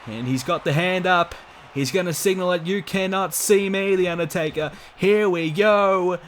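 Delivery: rising ringside hype, slowing on here we go; excited. Acted. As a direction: build up the big signature move. Building to finishing move.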